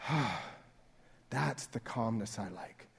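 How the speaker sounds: long exhale into soft, slow words; sigh of relief. Acted. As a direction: breathe out, relieved and easy. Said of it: "huge sigh of relief".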